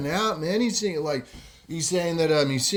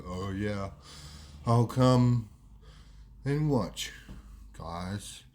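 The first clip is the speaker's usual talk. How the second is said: low, slow drawl with long pauses; Wado's slowed speech; 7 semitones lower, 4 dB quieter, much slower than usual. Retold or imitated. Slowed mate declining to play footy, slow low drawl. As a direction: drag it out, word by word. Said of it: "He's talking a lot slower than what he used to".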